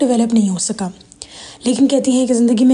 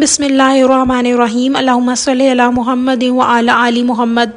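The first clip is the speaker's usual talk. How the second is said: measured, sustained Arabic invocation, louder at the start; prayer recitation; 5 dB louder, slower than usual. Acted. Woman opening her video with Arabic prayers, reverent recitation.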